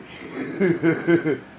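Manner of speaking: big high burst of laughter; loud amused laugh. Real, not acted. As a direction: crack up loudly. Laughing at own joke.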